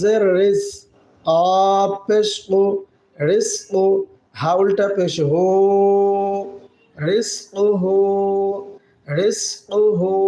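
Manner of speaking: slow joined syllables রিজ কু হু; teacher spelling out. Shown as a demonstration. Teacher drilling students, slow spelling. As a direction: build the word piece by piece. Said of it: "spelling out Arabic word".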